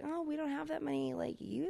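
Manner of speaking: high, soft mock-meek voice; small-bank employee. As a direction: play the little bank making excuses.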